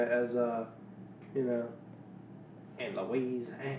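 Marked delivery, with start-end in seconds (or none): 2.8-3.8 a light imitation of a Louisiana rapper saying it. Podcast host quoting a hometown rapper, lightly imitated Louisiana delivery. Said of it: "as [name] would say".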